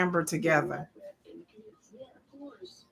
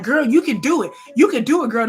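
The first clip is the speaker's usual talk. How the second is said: higher, louder hype-up; pep talk; 5 semitones higher, 6 dB louder than usual. Acted. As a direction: urge a friend on like a motivator. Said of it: "they pep talking you".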